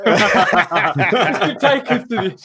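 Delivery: slow words broken by real laughter; laughing. Real, not acted. Speaker distracted by his sister, laughing through the words.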